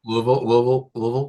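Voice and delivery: Louisville squashed to LUV-ul; marble-mouthed Louisville. Shown as a demonstration. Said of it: "like you have marbles in your mouth".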